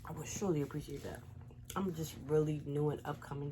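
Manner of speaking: normal talking voice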